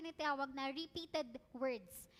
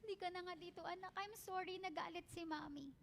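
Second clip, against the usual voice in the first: put-on much softer, gentle sorry to her child; guilty soft apology; 9 dB quieter than usual. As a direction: hush down like a mom making up. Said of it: "syempre ako magigilty". (mahinang paghingi ng tawad ng nanay sa anak)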